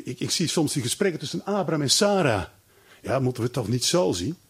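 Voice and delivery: usual speaking voice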